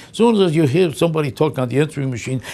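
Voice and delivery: ordinary delivery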